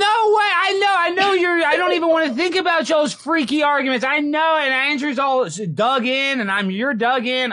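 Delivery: excited. Real, not acted. Host reacts to couple's fights.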